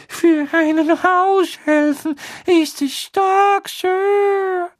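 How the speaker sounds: hoch und gequetscht, langsam; Dobby widerspricht höflich; Hauselfenstimme. A narrator's reading. Dobby voiced by narrator. (hohe, höfliche Elfenstimme)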